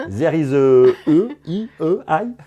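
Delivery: slow, laughing mock English; laughing tease. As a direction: tease her pronunciation through a laugh.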